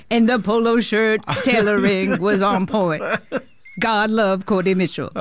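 comic impression of a gushing star; Adam Sandler. Director mimics Sandler praising the film, comic impression. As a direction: imitate a goofy, emphatic compliment. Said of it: "the real Adam Sandler voice".

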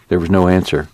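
plain reading voice

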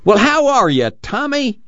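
high-pitched delighted greeting; high old-man voice, full of fond pride; proud, affectionate grandpa. A narrator's reading. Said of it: "grinned with affectionate pride".

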